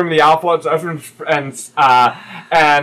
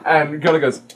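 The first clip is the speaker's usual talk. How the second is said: ordinary talk, nothing marked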